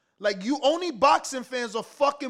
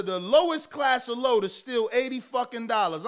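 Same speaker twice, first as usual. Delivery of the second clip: ordinary talk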